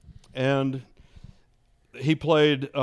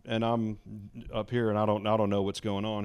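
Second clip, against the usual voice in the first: ordinary delivery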